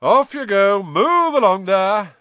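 very loud, high, stretched call; calling to a crowd. A narrator's reading. Lockhart dispersing the crowd, calling out. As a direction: shoo them along in a hearty shout. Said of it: "Lockhart called to the crowd".

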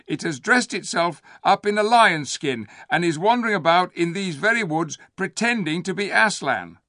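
high, loud scheming ape; sly ape voice. A narrator's reading. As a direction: proclaim it like a lying ape.

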